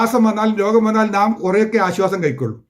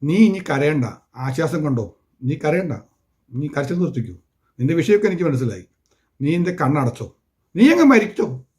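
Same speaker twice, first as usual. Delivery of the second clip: tender comfort; 7 semitones lower, 4 dB quieter than usual. Acted. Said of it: "എന്നിട്ട് പറയുകയാണ്".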